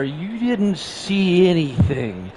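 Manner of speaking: slow, deliberate hypnotic voice; mock memory wipe. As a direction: wave it away, slowly. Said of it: "Like the penguins from Madagascar".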